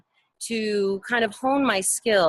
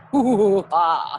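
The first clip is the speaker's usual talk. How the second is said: spooky ghost voice; 5 dB louder than usual. Retold or imitated. Imitating haunted house voice.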